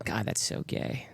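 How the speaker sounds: whispered, breathy aside. Real, not acted. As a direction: drop to a whisper for the joke. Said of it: "whispered aside".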